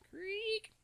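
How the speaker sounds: high creaking door noise; door creak imitation. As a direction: squeak it out like a slow door. Front door opening at night.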